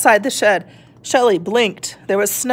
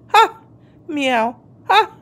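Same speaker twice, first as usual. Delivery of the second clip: mocking meow laugh; 5 semitones higher, slower than usual. Cat laughs and meows.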